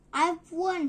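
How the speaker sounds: loud, high breathless cry; gasped win. Acted. Edward at the finish, loud happy gasp. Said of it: "he gasped".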